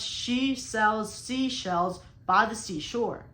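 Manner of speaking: slow, deliberate, every word pronounced clearly; slow careful tongue twister. Shown as a demonstration. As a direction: say it slowly so each sound lands right. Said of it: "let's say I'm trying to pronounce".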